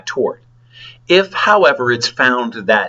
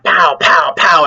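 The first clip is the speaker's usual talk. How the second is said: louder "pow, pow, pow", each one punched; mock slap sounds; 5 semitones higher, 3 dB louder than usual. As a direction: rattle off the slaps like comic-book hits. Retelling slapping the bouncer.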